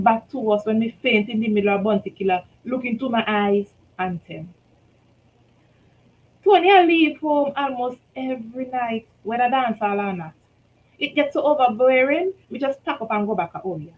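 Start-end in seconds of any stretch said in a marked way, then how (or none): none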